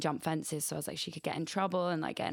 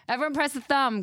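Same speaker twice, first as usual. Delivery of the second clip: higher and louder, rallying the audience; loud urging; 9 semitones higher, 7 dB louder than usual. Real, not acted. Host urges the listeners to press the thumb, loudly. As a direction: push it out as a call to everyone. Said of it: "repeats it to the audience".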